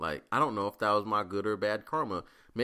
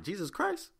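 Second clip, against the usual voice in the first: much higher, wondering inner voice; 8 semitones higher than usual. Retold or imitated. Thinking it's a sign from the Lord, high amazed. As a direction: marvel as if hearing from above. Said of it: "In my head, I'm like".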